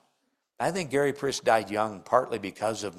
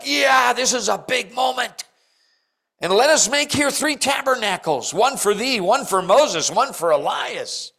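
excited and pitched up high, louder; Peter's eager inflection; 9 semitones higher, 6 dB louder than usual. Acted. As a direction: gush with the awe of a big moment. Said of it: "I'm giving the inflection".

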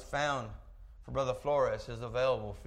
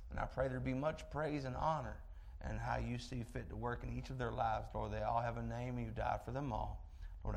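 soft, steady prayer voice, a touch lower; 3 semitones lower, 5 dB quieter than usual. Real, not acted. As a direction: pray quietly over the sick and the church. Opening prayer before the sermon.

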